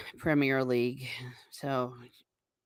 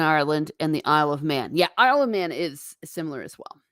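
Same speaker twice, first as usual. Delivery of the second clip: ordinary talk, nothing marked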